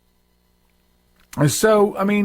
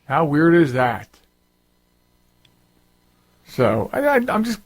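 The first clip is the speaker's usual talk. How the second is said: ordinary talk, nothing marked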